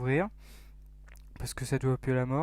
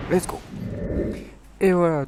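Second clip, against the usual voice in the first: a louder, higher cheer; hyped; 4 semitones higher, 7 dB louder than usual. Real, not acted. Player cheers as the parachute jump starts, hyped. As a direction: fire it off as a hype cry. Jumping from the plane.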